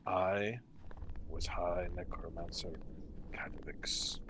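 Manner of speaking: low, soft, breathy ghostly lament; a lost spirit grieving over rubble, quietly; mournful ghost. Acted. Ghost laments the fall of Ythryn, low and whispery.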